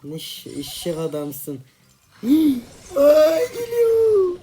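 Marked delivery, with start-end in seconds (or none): 2.2-4.4 high squealing cry of fake fright; mock panic squeal. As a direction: squeal in hammed-up terror as the enemy comes. Enemy approaching, panicked squeal.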